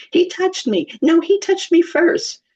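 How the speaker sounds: high, slow whiny-child imitation; mock tattling children; squabbling kids. Two kids bicker in the minivan, mock whining.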